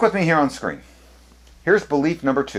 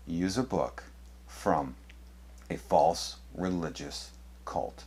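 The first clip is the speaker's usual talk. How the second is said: low, hushed, slow with heavy pauses; slow, grave question; 5 semitones lower, 12 dB quieter, slower than usual. Real, not acted. Asks Adventists about their source, slow and grave. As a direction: drop the voice and set each word apart.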